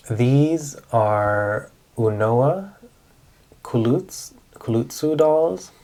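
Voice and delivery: "Unowa Kulutsu" spelled out slowly, syllable by syllable, with an English accent; slow, hesitant try at the name "Kulutsu dolls"; careful Japanese name. Real, not acted. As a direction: sound out "Kulutsu" slowly and unsure, then repeat it. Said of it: "I know that sounds weird".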